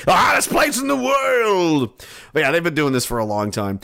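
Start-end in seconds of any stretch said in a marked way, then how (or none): 0.1-2.0 high, drawn-out mock shout; mock dramatic cry. Joking about airport runway temperatures, mock dramatic cry. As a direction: cry it out slowly, high and over the top.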